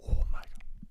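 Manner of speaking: voiceless, whispered reaction; stunned whisper in a retelling; whispered disbelief. Retold or imitated.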